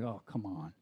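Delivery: low, soft dismissive scoff; muttered under the breath, unconvinced; cynical scoff. Retold or imitated. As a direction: brush it off like a cynic. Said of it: "my cynical mind".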